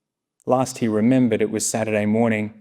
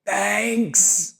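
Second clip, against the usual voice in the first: huffed; 10 semitones higher, 3 dB quieter than usual. Acted. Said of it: "Retriever huffed with a dopey smile".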